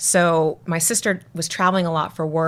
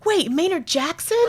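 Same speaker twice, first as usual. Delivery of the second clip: surprised; 12 semitones higher than usual. Retold or imitated. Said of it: "he was like".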